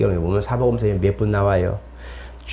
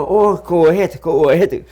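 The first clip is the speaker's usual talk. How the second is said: easygoing Filipino caller; 5 semitones higher, much slower than usual. Retold or imitated. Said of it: "쏘리 하는데도 웃음을".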